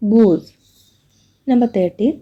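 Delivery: normal talking voice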